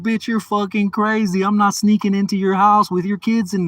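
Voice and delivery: high, heated retold argument; indignant refusal. Man to woman wanting him to sneak in, indignant. Acted quarrel, refusing her request.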